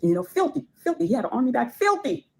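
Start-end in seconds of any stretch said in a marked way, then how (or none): 0.0-1.0 high-pitched disgusted filthy; disgusted emphasis. Real, not acted. Disgusted at his dirty bags. 1.7-2.3 filthy said much higher, disgusted. Real, not acted. Disgusted at his dirty bags.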